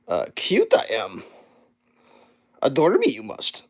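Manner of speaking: mock Yoda croak. Yoda-style inverted sentences.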